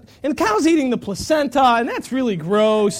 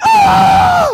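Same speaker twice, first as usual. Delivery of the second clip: sudden very high, loud scream of pain; 11 dB louder than usual. Retold or imitated. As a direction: yelp as the brand hits your arm. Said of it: "I screamed and was like".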